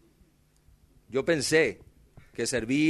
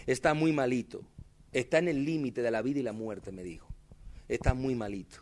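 much softer, hushed report; soft bad news; 6 semitones lower, 10 dB quieter than usual. Nurse telling the pastor the baby is dying, hushed. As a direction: break it gently, low and soft.

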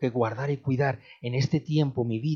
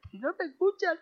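high wailing words; high shouted child's sob; crying child. Retold or imitated. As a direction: sob it out like a small boy. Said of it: "Y decía".

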